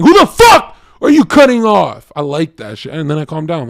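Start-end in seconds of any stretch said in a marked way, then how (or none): 0.0-2.1 high, loud furious yell; road rage shout. Retold or imitated. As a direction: bellow it at the driver who cut you off. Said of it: "I like saying".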